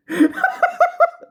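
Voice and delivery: very high squealing laugh, loud; real helpless laughter. Real, not acted. Finding own impression funny, high squealing laughter. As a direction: let out shrieking peals of laughter. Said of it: "This is funny".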